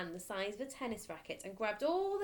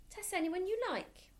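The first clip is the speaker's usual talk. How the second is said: much higher, eager challenge; small boy; 12 semitones higher than usual. Acted. Boy challenging the headmistress, high and eager. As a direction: pipe up like a cheeky child.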